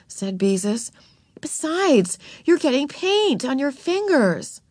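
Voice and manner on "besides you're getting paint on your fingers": higher-pitched bossy correction; scolding older sister. A narrator's reading. Older sister corrects Ramona's painting, bossy and higher. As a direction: tell off a little sister.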